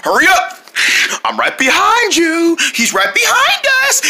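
high, breathless chase calls; excited shouting. Acted. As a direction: yell while running.

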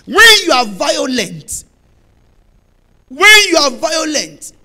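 slow loud repeated cry; emphatic pulpit chant; preaching refrain. Acted. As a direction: drive the refrain home.

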